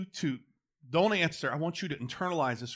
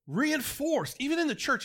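much higher emphatic repeat; 12 semitones higher than usual. Real, not acted. Preacher repeats with more force.

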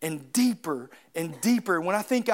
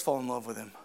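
ordinary delivery

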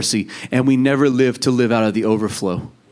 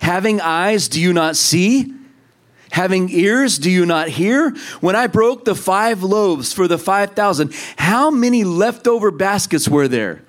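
high, slow pleading question; voice of Jesus; 4 semitones higher than usual. Acted. Jesus questioning the disciples, high slow plea. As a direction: ask it slowly, higher, almost aching. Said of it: "And he says".

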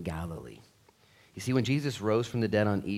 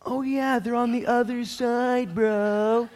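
much higher, slow mocking dude voice with a laugh; sarcastic fisherman; 9 dB louder, slower than usual. Fishermen mocking the advice from the beach in a sarcastic dude voice. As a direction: roll your eyes at the stranger. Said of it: "it's like".

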